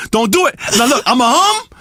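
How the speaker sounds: ordinary delivery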